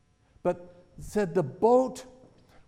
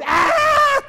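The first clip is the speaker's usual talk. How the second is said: high piercing scream of grown men; loud frightened shriek; terrified scream; 15 dB louder than usual. Retold or imitated. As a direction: scream out in terror. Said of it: "screaming out with a loud voice".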